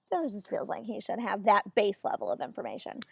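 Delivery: hushed confiding whisper; whispered aside. Real, not acted. Whispering an aside to the viewer, hushed.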